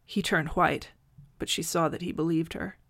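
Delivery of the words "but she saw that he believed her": ordinary delivery, nothing marked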